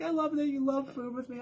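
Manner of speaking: high, soft imitation of gushing. Friend gushing about shared food, high gushing imitation.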